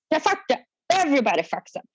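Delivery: badly faked gruff chief; lightly voiced old-timer brushing it off; chief's guy voice. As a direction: do a half-hearted salty guy. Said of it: "I just can't do a guy voice".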